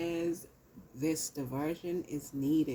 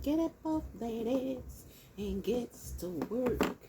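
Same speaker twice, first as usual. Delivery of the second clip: higher preachy spirit voice; lightly voiced divine command; Holy Spirit voice; 6 semitones higher than usual. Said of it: "This is what the Holy Spirit's saying".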